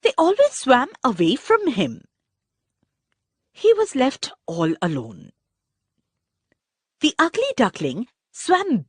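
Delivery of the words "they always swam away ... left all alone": ordinary delivery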